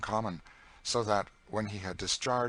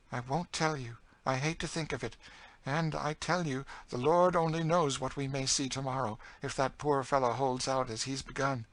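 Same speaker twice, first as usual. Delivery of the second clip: raised, foreboding voice; higher, bitter story voice; grim older captive character; 6 semitones higher, 3 dB louder than usual. Acted. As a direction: refuse to tell, then warn darkly. Cassie refuses to tell.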